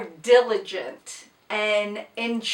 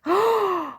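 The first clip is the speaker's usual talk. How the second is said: gasp; 8 semitones higher than usual. Real, not acted. Finding a rotary cutter.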